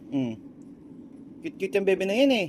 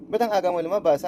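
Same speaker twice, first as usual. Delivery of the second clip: normal talking voice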